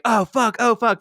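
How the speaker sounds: hammed-up panic; mock panic. Onita as the seconds count down, in mock panic. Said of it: "you can see him being like".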